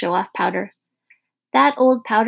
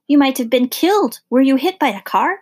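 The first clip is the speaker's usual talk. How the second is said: alarmed; 5 semitones higher, 5 dB louder than usual. A narrator's reading. Mother alarmed at injured son.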